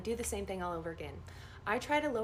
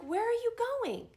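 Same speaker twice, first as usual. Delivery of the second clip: surprised; 14 semitones higher, 4 dB louder, faster than usual. Retold or imitated. Dream, surprised to see patient.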